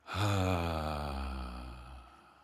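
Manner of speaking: long audible sigh out; voiced sigh. Shown as a demonstration. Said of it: "feel your voice in your body as you sigh".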